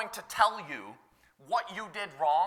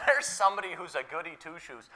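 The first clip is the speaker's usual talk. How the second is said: ordinary delivery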